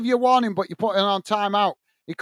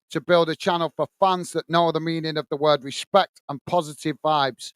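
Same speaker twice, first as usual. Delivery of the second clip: normal talking voice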